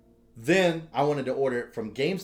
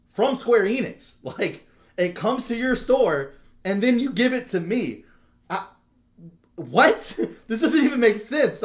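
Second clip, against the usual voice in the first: high, loud, slow disbelieving rant, laughing through it; incredulous rant; 10 semitones higher, 8 dB louder, slower than usual. Retold or imitated. Telling off the GameStop clerk in disbelief, high and loud. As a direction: spell it out like he's an idiot.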